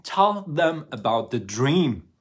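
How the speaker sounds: slow, loud urging call; urging shout. Retold or imitated. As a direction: call out to him to tell the dream. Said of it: "So she says".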